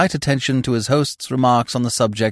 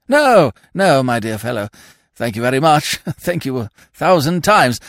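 higher, louder stammering peer; flustered lord; 6 semitones higher, 6 dB louder than usual. A narrator's reading. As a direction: decline hastily as his flustered lordship. Lordship hastily declines.

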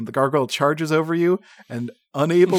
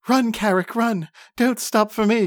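raised urgent halfling voice, lightly higher; halfling crying out; 8 semitones higher than usual. A narrator's reading. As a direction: call out to your friend to flee. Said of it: "cries the halfling".

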